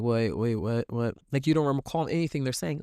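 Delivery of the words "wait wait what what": mildly acted zoned-out listener, lower pitch; glazed-over listener. Listener who has not been following, lightly acted glazed-over confusion. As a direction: lightly play someone who missed it all. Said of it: "glaze over".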